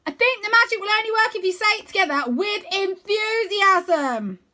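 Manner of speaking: calling down in a big high cartoon voice; bright playful wizard-boy voice; Wyn's voice. A narrator's reading. Wyn explains.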